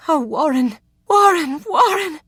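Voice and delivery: high, loud theatrical swoon on each Warren; a woman hamming up adoration to tease him; sighing, swooning. Acted. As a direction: melodramatic flirtatious gushing. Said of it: "She sighed deeply and fluttered her lashes".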